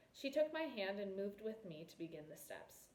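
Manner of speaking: ordinary delivery, nothing marked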